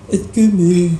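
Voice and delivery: loud, badly articulated mock deaf speech; pretend deaf speaker. Pretending to be deaf to a customer, loud slurred imitation. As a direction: fake a deaf person's speech, loudly. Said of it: "I pretended I was deaf".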